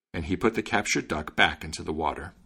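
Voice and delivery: usual speaking voice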